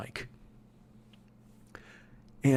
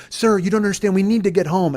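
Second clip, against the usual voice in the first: higher, louder mock pleading; insistent pleading; 6 semitones higher, 5 dB louder than usual. Woman pleads to get home, insistent pleading. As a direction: imitate a passenger urgently protesting.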